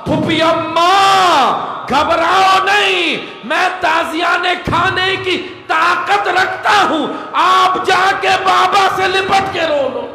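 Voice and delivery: high, slow wailing plea; tearful cry. Acted. Nephew reassuring his aunt while being whipped, tearful cry. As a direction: stretch the words into an anguished, ringing cry. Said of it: "रोती आंखों से कहा".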